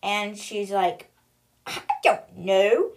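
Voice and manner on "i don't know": slow, loud, drawn-out bafflement; baffled. Retold or imitated. Avery stumped by the boy's riddle, loudly baffled. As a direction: stretch it out as if totally stumped.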